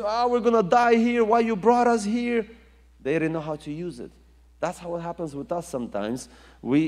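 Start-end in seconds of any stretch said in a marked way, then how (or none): 0.0-2.4 high, loud wailing complaint; mock panicked cry of the people; panicked Israelites crying out. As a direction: whine in panic like trapped people. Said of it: "They start crying and saying".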